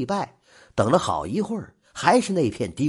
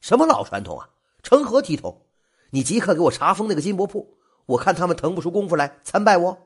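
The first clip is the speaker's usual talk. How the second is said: louder and higher, fast indignant outburst; raised angry voice; 5 semitones higher, 3 dB louder, faster than usual. Acted. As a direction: blast the order at the clerk, pitch jumping up. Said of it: "嗓门不由得提高了八度".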